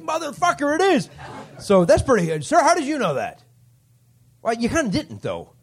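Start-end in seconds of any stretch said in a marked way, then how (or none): 0.0-1.1 higher, shocked swear; a retold jolt of surprise; stunned realization. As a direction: realize it all at once. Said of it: "I'm like".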